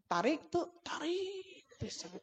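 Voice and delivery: hushed, rising in pitch; soft, high murmur; softened voice. Retold or imitated. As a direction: keep it very soft while remarking to a child. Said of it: "Jadi suara saya tuh dipelankan".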